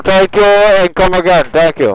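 yelling it out triumphantly; shouting. Real, not acted. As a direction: bellow the brag into the mic. Bragging to friends on voice chat.

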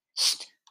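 short hissed "sst"; hissed shush. A narrator's reading. Jules silences Andy to keep listening, hissed shush. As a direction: shush them with a quick hiss. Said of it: "siste Jules".

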